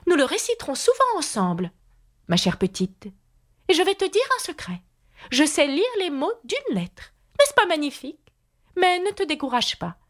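high-pitched, encouraging queen. A narrator's reading.

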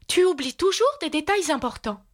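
high-pitched chiding. A narrator's reading. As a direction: chide her in a high queenly voice.

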